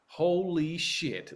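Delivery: very slow, stretched exclamation; slow stunned Holy shit; drawn-out holy shit. Retold or imitated. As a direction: drag out each word in mock shock. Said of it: "I still say with that intonation".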